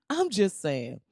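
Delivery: usual speaking voice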